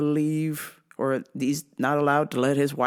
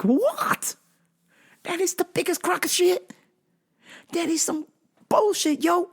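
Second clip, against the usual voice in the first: indignant; 12 semitones higher than usual. Real, not acted. Indignant outburst, much higher.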